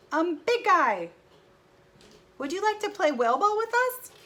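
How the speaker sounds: high, loud hopeful invitation; Piggy inviting the big guy to play, high and loud; eager Piggy. Acted.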